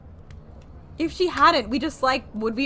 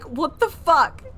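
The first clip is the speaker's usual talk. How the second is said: slow, louder mock outrage; 6 semitones higher, 9 dB louder, slower than usual. Streamer voicing Darklaw's disbelief, slow loud mock outrage. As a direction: drag it out in put-on disbelief. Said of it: "Darklaw's literally like".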